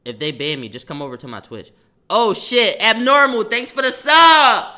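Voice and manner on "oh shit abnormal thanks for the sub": excited thanks. Real, not acted. Reacting to a sub on stream.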